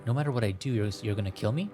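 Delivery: ordinary talk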